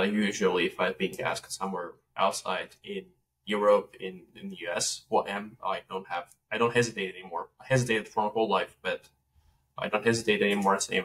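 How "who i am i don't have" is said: ordinary talk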